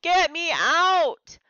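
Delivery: high, loud, drawn-out bawl. Acted. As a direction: bawl it in a panic. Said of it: "I bawled".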